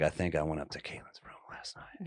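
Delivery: panicked hushed whisper. Retold or imitated. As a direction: whisper the confession. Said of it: "I'm like".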